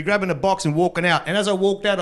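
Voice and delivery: ordinary talk, nothing marked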